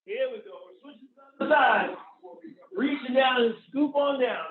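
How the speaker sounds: loud coach encouragement; barking gym coach. Real, not acted. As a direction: push them to hold it.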